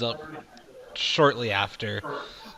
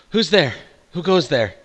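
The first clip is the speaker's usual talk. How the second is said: higher, louder call through a door; wary challenge; 6 semitones higher, 7 dB louder than usual. Acted. Woman challenging an unexpected visitor at her door, wary call. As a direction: demand to know who is knocking.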